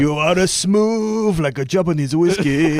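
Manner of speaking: a light Welsh lilt, slow and crooning; Welsh impression. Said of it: "That's my Welsh impression".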